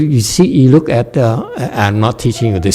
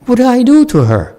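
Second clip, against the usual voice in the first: high-pitched, wronged question; much higher voice, as if wrongly blamed; baffled first reaction; 12 semitones higher than usual. Acted. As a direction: act out a bewildered protest. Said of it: "That's my first reaction".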